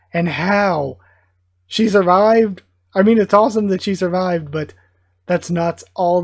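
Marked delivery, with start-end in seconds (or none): 0.1-2.6 can't believe she made it; gasped, loud disbelief; shocked disbelief. Real, not acted. Shocked at a character surviving.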